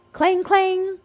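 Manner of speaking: high ringing clang clang; bell clang imitation. A narrator's reading. Fire Chief F ringing the bell after the fire, high ringing clang. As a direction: ring it loud like a fire bell.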